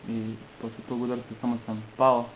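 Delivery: ordinary talk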